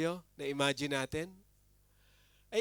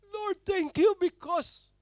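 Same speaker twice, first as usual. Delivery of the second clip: grateful cry; 14 semitones higher, 5 dB louder, slower than usual. Pretend. Acting grateful onlookers' prayer.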